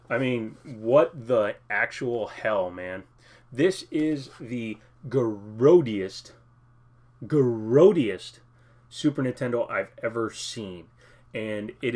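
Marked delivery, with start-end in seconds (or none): 3.5-6.3 disgusted drawn-out. Real, not acted. Disgusted at dirty console. 7.3-8.4 grodiest repeated much louder in disgust; disgusted emphasis. Real, not acted.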